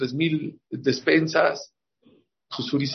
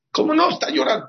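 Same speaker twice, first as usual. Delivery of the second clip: alarmed; 13 semitones higher, 4 dB louder than usual. Acted. Student alarmed at crying.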